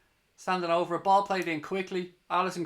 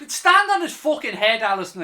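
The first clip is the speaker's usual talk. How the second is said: furious shout; 5 semitones higher, 11 dB louder than usual. Real, not acted. Fan yelling at keeper.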